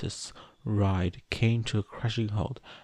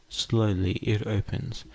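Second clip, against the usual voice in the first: ordinary delivery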